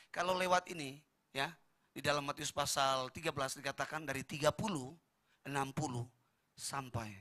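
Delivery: ordinary delivery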